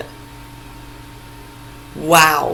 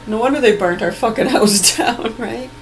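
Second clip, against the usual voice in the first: normal talking voice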